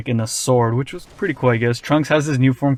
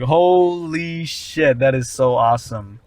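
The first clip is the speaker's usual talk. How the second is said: amazed; slower than usual. Retold or imitated. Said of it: "And I'm like".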